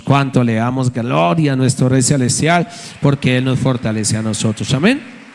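loud building pulpit cry; full preaching. Acted. Pastor rallies the congregation with loud preaching. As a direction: lift the church with it.

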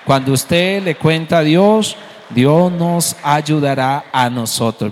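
loud, slow, stretched proclamation; emphatic preaching. Real, not acted. Preacher promising the congregation God's help, loud and emphatic. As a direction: drive each phrase home at full volume.

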